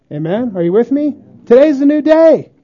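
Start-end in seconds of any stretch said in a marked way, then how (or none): 1.4-2.5 louder, higher proclamation; preaching. Acted. Preacher proclaims new mercy to the congregation, full force. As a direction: ring it out like a revival call.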